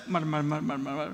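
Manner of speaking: low, soft mutter mutter. Shown as a demonstration. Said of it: "mutter's an onomatopoeia".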